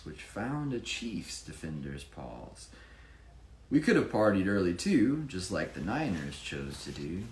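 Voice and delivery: sing-song picture-book narration; rhyming story reading. A narrator's reading. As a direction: read the rhymes to a child with energy. Reads rhyming story aloud.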